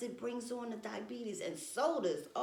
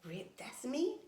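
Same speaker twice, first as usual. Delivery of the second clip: stunned disbelief; 3 semitones higher than usual. Retold or imitated. Vlogger imitates herself seeing an old photo, hushed shock. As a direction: breathe it out, shocked at your own photo. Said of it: "I look at it now and I be like".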